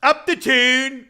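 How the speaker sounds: mock British woman, loud, drawn out and high; imitated British accent. Quoting a woman interviewed on the street, imitated British accent. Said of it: "And she's like".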